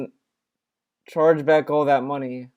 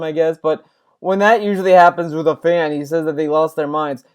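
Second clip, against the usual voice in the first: usual speaking voice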